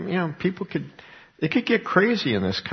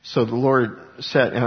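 ordinary delivery

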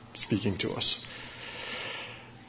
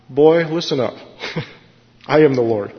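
stern God voice; 3 semitones higher than usual. Retold or imitated. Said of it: "the way I think of it is it's kinda like".

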